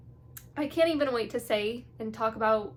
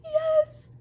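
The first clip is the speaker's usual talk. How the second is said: a much higher, louder yes, yes; cheering yes; 13 semitones higher, 7 dB louder than usual. Retold or imitated. Rooting for the couple, cheering yes high and loud. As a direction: cheer like you're rooting in the stands. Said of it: "I'm like".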